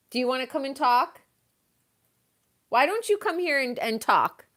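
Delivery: high sweet voice calling a pet; coaxing call. Real, not acted. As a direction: coax the pet over sweetly.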